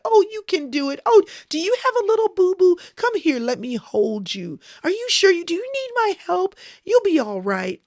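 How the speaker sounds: put-on high, cooing mother soothing a child; baby an upset toddler, way up high; mommy voice. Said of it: "Your mommy voice is the easy voice".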